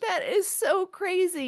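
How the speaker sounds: very high-pitched real amazement; amazed reaction. Real, not acted. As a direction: marvel at it in a soaring voice. Host reacts to astonishing story.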